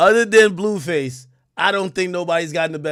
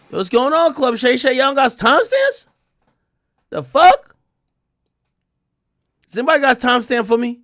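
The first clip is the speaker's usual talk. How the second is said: raised, high-pitched questioning; indignant complaint; 7 semitones higher, 5 dB louder than usual. Real, not acted. Host scolding the show over timestamps, loud indignation. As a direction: call out loudly in disbelief.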